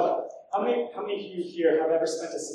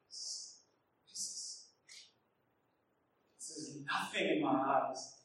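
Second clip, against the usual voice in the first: disappointed; 9 dB quieter, slower than usual. Retold or imitated. Voicing disappointed elders.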